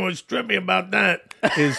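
put-on Long Island accent.

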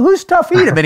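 high, puzzled who's that; baffled question. Retold or imitated. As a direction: ask it high, totally clueless. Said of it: "I go".

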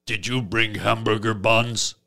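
deadpan brute asking a dumb question; deadpan caveman Mongo asking about buns; caveman talk. Retold or imitated. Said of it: "he still talks like this".

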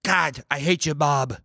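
Tony Soprano. Acted. Imagining AI Tony Soprano scolding his son, stilted mobster dad. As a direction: scold your son in halting, oddly paced chunks. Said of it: "Tony Soprano being like".

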